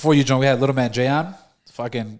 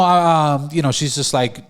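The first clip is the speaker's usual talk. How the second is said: normal talking voice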